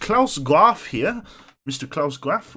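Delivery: slow, hammed-up German accent on the names; bad German accent. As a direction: lean into a cheesy German accent, slowly. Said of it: "that's a terrible German accent".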